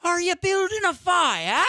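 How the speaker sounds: high, slow, bad leprechaun voice; drunk pirate leprechaun. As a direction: squeal it out slowly like a tipsy pirate leprechaun. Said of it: "like a drunk pirate leprechaun".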